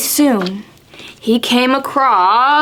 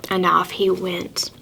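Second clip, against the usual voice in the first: usual speaking voice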